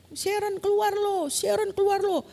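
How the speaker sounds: jeering classmates. Retold or imitated. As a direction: mimic a class ordering someone out. Whole class stands and answers.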